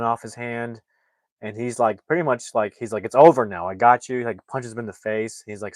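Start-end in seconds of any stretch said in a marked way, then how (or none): 3.0-4.2 loud, commanding and final; forceful shout. Retold or imitated. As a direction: shout it down at him. Chris subdues the killer, retold.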